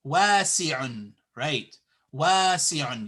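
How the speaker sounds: wasi'un with a long first a; wasi'un, first syllable drawn out; long vowel. Shown as a demonstration. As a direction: hold "waa" in wasi'un. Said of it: "You say wasi'un".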